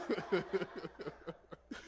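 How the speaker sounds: trailing laugh after teasing; amused chuckle. Real, not acted. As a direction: chuckle at your own joke. Laughs at own teasing remark.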